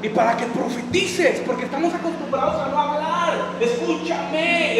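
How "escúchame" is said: slow escúchame. Real, not acted. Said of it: "slow emphatic appeal".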